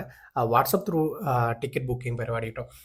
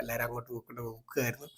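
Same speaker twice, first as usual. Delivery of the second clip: soft unvoiced whisper; 9 dB quieter, faster than usual. Real, not acted. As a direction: murmur it like a secret. Aside about people looking around.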